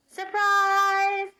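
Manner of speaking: big, high excited call; shouted surprise. A narrator's reading. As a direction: burst out to greet the birthday girl. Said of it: "called Kristoff".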